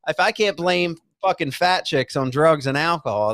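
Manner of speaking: ordinary talk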